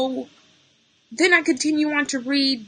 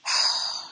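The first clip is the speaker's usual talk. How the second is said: long unvoiced sigh; heavy sigh; 6 dB quieter than usual. Real, not acted. Streamer pausing over a friend's death, sighing. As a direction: let the breath out wearily.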